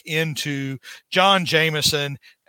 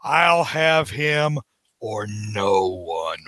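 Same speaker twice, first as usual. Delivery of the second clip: menacing villain vow; 7 semitones lower, much slower than usual. Acted. Norman claims his place at Oscorp, slow low menace. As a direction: let the threat drop into a slow low hush.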